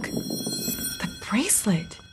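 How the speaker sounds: hushed, slow breathy whisper; whispered discovery. Acted. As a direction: breathe it in awe. Discovering something in dark house.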